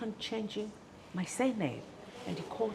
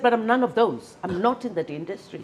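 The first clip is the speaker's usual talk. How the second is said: loud protest; 10 dB louder than usual. Retold or imitated. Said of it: "I said".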